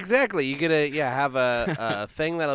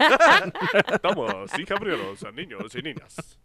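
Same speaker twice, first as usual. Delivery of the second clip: ride announcer voice; 5 semitones higher than usual. Pretend. Mock theme-park ride announcer.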